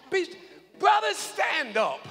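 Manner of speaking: slow, high shouted call to stand; rousing shout. Real, not acted. Pastor shouting to the brothers to stand up. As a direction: call the men up loud and slow.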